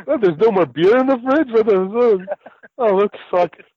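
rudely awakened, swearing at the empty fridge; Rod voice. Retold or imitated. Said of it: "And that's my Rod voice".

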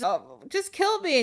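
high, drawn-out mock plea; mock despair. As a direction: whine it slowly, hamming up the despair. Distressed heroine begs for death.

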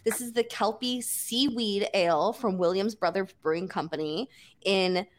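normal talking voice

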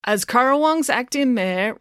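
high, slow, stilted speech reading. A narrator's reading. Mister Lang reading his notes, slow speech voice. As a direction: read it out carefully to a crowd. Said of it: "read Mister Lang".

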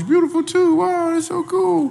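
squealing high praise; gushing falsetto. Acted. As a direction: coo over her in a high voice. Gushing over daughter.

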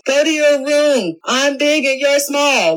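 scolding parent. Retold or imitated. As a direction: talk down like a parent sending a kid to their room. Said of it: "like a parent, like a kid".

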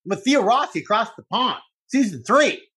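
playfully imitating himself hollering for the phone; half-hearted comic yell; retold yelling at Diana. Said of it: "and I'm going".

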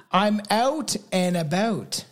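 slow, churched-up "out and about"; overdone, drawn-out Canadian accent; over-careful Canadian accent. Shown as a demonstration. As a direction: dress up the Canadian vowels, slowly. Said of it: "trying to church it up too much".

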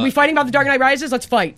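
loud, fast, high mock challenge; mock fired up. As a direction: ham up a fake brawl call. Joking about fighting in chat.

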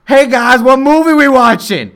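high, booming call to the room; loud oblivious moviegoer. Retold or imitated. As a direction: imitate a guy hollering into a cinema. Retold loud latecomer in cinema.

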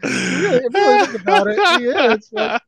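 squeaky high laugh-talk; words breaking up into laughter. Real, not acted. Laughing while ranting about Kiffin.

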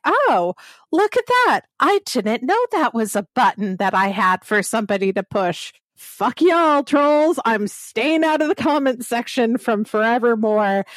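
retold sassy retort, pitched well up; retold indignant clapback. Podcaster answering a troll who doubted her accent, retold indignant clapback. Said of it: "And I was like".

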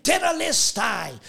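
high ringing cry of the word Tetelestai; exclaimed. Retold or imitated. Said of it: "he exclaimed".

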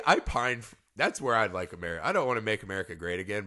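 normal talking voice

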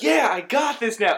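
loud, high overconfident whoop; cocky excitement. Acted. Ant-Man boasting as he grows, loud cocky excitement. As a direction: crow it like an overeager hero.

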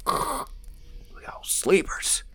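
hushed whisper, slowing down. Acted. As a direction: breathe it out so nobody wakes. Sneaking past sleepers in a room.

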